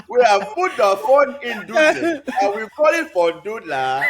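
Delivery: put-on laughing Nigerian accent salesman; hammed-up Nigerian accent pitch, giggling. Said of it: "in a Nigerian accent".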